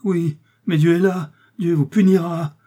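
high, loud condemnation; agonised, vengeful outcry; dying man's curse. A narrator's reading. Dying man curses his supposed poisoner.

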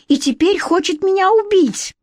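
high-pitched plaintive accusation; tearful outcry. A narrator's reading. Queen tells twins of threat, tearful outcry. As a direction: wail it lightly, pitch well up. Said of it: "всхлипнула Шапочка".

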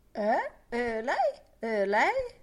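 a hint of a booming, puzzled man; vague Ben Dollard. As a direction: answer distracted and loud. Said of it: "rispose il vago basso di Ben Dollard".

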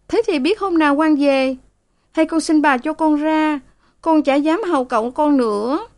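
put-on high, wailing young maid; maid bursting into tears. A narrator's reading. Maid bursts out pleading to leave, high and tearful. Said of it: "Sen òa lên".

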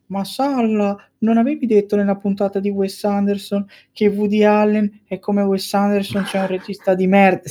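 high mocking heckler voice; mocking listener voice. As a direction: squeak out the heckler's jab. Imagined listener objection.

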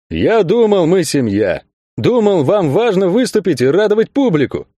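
high-pitched, wounded protest; feigned hurt. A narrator's reading. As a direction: play the offended party. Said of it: "сделанной обидой произнёс".

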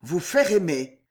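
plain reading voice

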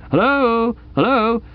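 high, questioning telephone hello; telephone caller. Acted. Caller waiting on a dead line, high-pitched hello. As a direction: call hello down a silent phone line. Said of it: "like one of those telephone conversations".

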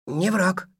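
high, submissive creature echo; obediently repeating, high and small; meek creature voice. Acted. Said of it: "покорно повторил Мартыш".

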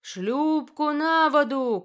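slow, loud, high shout; shouted order. A narrator's reading. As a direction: bellow the command across the deck. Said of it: "крикнул он".

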